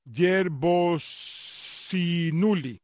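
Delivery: Yerbosinuli drawn out very slowly, piece by piece; slow careful repetition. Shown as a demonstration. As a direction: sound out Yerbosinuli slowly for the listener. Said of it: "Voy a repetir eso otra vez". (Yerbosinuli muy lento, por partes)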